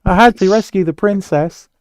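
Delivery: high, loud movie-character impression; John Candy. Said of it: "listening to John Candy's voice".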